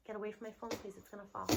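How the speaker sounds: low quick aside; quiet aside. Real, not acted. Narrator aside to child.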